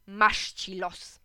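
slow, resigned play-acting; pretend weary sigh. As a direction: drag it out like a tired dad. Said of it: "udałem, że zamykam gazetę i powiedziałem". (powoli, udawane westchnienie)

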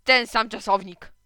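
slow, loud seething order; slow, forceful angry command; through clenched teeth. A narrator's reading. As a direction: order them off with teeth held shut. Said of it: "nie poruszając zębami".

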